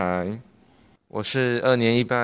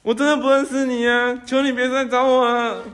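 high, fast frightened begging; much higher, fast and scared; panicked pleading; 11 semitones higher than usual. Acted. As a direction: beg the ghost to leave you alone. Boy begs ghost again.

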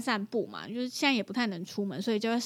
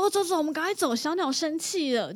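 hurrying her son; 5 semitones higher than usual. Retold or imitated. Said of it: "我就说".

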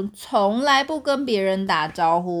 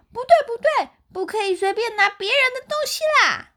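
high, fast shouted telling-off; rabbits shouting; 14 semitones higher, faster than usual. A narrator's reading. Rabbits shouting at the bear running off. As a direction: yell it quickly after him. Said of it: "小兔子们赶快大叫".

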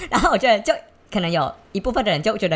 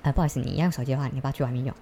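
polite quiet request; 7 semitones lower, 8 dB quieter, faster than usual. Retold or imitated. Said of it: "我就讲说".

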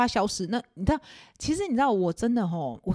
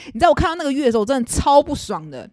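indignant; 6 semitones higher, 7 dB louder than usual. Real, not acted.

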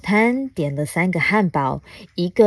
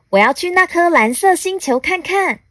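higher-pitched alien storybook voice; eager little alien; 10 semitones higher, 4 dB louder than usual. Acted. Alien Baba Lu announces a trip to the blue planet, eager.

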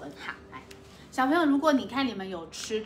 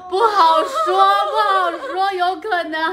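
laughing exclamation; 7 semitones higher, 7 dB louder than usual. Real, not acted. Laughing outburst at kid's comment.

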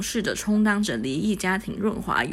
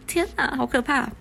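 mock horror; 6 semitones higher than usual. Reader's mock horror aside.

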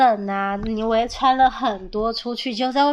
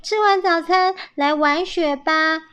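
bright-spirited higher call, stretched ending; happy; 6 semitones higher, 4 dB louder than usual. A narrator's reading. Young squirrels suggesting snow play after breakfast, happy. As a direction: happily invite others out to play, voice lifted. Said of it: "开心地说".

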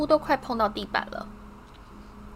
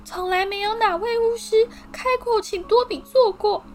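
high elf voice, emotional and louder; tearful house-elf; 11 semitones higher, 4 dB louder than usual. A narrator's reading. Dobby tells Harry no wizard treated him as equal, tearful high voice. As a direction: marvel through tears.

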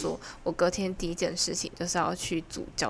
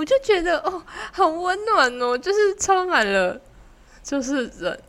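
high, slow, teary voice; touched, near tears; 9 semitones higher, 5 dB louder, slower than usual. Retold or imitated. Recalling the owner serving food, teary and touched. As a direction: let the voice well up, half mock. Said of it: "啊，你有哭出来吗？".

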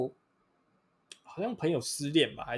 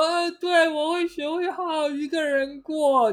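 much higher, slow solemn promise; earnest mock reply; 12 semitones higher, slower than usual. Yating hugging her sister back, earnest mock vow. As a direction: vow it like an idol drama. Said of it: "很认真的这样抱回去说".

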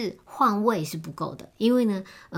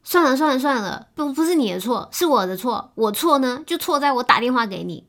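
higher and louder, a sulky outburst; huffy girlfriend; 7 semitones higher, 7 dB louder than usual. Retold or imitated. Girlfriend hanging up on boyfriend, high, loud and sulky. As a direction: give up on him in a huff. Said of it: "然后女生就说".

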